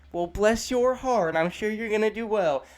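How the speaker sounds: slow, high-pitched false sympathy; drawn-out sweetness that hides an insult; passive aggressive. Acted. Elderly lady judging someone's prospects, passive-aggressive sweetness. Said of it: "passive aggressive".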